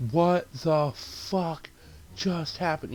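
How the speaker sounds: drawn-out, very slow disbelief; stunned disbelief. Retold or imitated. Viewer reacts to the shock death, stunned disbelief.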